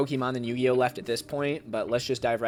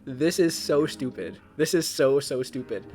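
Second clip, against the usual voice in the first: excited; 3 semitones higher, 3 dB louder than usual. Real, not acted. Disbelief at many tens.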